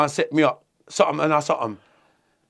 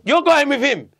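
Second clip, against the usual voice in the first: higher, louder imitated command; retold firm order; 8 semitones higher, 6 dB louder than usual. Warder sending him off with a stranger, commanding. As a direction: order him along, voice raised.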